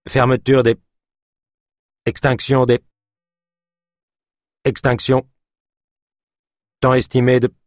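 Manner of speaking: failing computer. Acted. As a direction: stutter like a dying machine. Computer shutting down.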